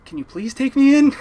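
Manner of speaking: loud, high plea to be let in; pleading. Retold or imitated. Kid begging grandpa to take them inside, loud pleading.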